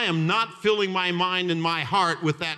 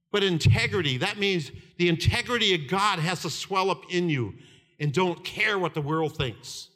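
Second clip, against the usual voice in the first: ordinary talk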